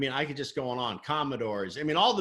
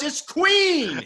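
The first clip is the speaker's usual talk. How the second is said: much higher and louder, laughing; laughing reveal; 12 dB louder than usual. Retold or imitated. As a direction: burst out with the punchline.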